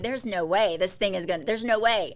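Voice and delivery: loud, desperate no way; panicked protest. Retold or imitated. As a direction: protest loudly mid labour, sure it can't happen. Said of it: "I was like".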